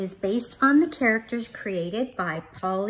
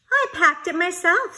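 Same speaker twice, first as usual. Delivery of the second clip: proud little bear; 9 semitones higher, 7 dB louder than usual. Acted. Bear proud of his lunch.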